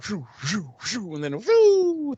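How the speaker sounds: soft high shoo-shoo then rising whoo; whooshing throw noises. Retold or imitated. Host mimics Angel spinning the tank, soft whooshing sounds. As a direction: mimic a discus spin with breathy whooshes.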